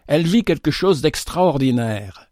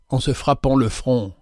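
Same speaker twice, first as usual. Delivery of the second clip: ordinary delivery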